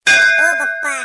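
a sudden loud call of पप्पा. Acted. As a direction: shout to cut his father off. Son calls out to father. (वडिलांना मध्येच अडवण्यासाठी मोठ्याने हाक)

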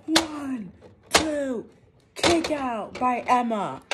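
normal talking voice

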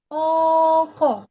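ordinary talk

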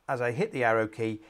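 ordinary delivery

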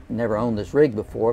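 ordinary talk

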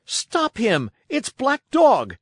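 high, urgent alarm call; loud, high-pitched cry. A narrator's reading. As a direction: shout to stop a fugitive. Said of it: "I cried".

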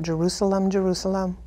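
low, hushed lament; lamenting cry. Retold or imitated. Jesus mourning the real city, soft and low. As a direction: grieve over the name, softly. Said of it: "he cried out".

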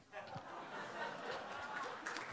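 an airy, unvoiced burst of laughter; breathy laugh. Real, not acted. Speaker laughs at his grandson's Pokemon answer in an airy burst. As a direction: laugh at the punchline under your breath.

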